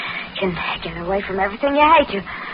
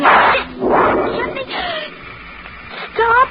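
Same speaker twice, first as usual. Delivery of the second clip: desperate plea; much slower than usual. Acted. Vera begging as he calls police.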